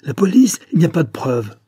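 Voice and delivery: high, loud outburst, falling off; inhuman tone. A narrator's reading. Cornered Lambert denying proof, inhuman tone. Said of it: "d'un accent qui n'avait rien d'humain".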